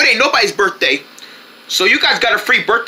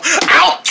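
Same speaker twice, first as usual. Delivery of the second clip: pained yelp; 7 semitones higher than usual. Acted. Character gets hurt.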